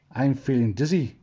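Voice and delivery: usual speaking voice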